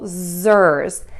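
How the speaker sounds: "zers" drawn out with voiced Z sounds; isolated second syllable. Shown as a demonstration. Teacher moves to the second syllable, sounded-out "zers". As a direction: buzz and stretch "zers". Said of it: "move to the second syllable".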